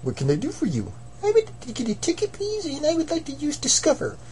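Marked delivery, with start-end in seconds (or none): 1.2-4.2 much higher, soft cartoon voice; squeaky high character voice. New customer cheerfully greeting Mister Monkey, high and soft.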